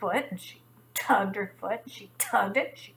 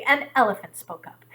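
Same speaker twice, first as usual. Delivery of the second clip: usual speaking voice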